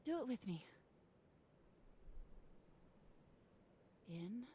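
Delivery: calm breathing guide. Acted. Guiding breathing to calm her.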